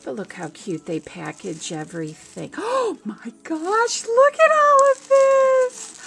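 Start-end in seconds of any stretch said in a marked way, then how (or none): 2.6-6.0 high, loud gasping squeal; excited squeal. Real, not acted. Opening Maureen's box for viewers, a loud high squeal. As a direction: shriek with joy at the contents.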